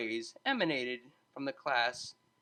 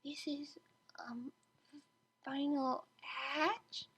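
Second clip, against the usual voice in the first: timid, breathless whisper, high and slow; nervous whisper; 11 semitones higher, 7 dB quieter, much slower than usual. A narrator's reading. Small mare nervously whispering the DJ's name. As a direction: barely dare to say it. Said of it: "a small mare said nervously".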